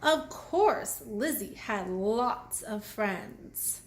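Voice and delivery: ordinary talk, nothing marked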